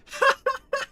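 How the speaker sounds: high, loud laughing burst; burst of laughter. Real, not acted. Streamer laughing at a game line, high loud laugh burst.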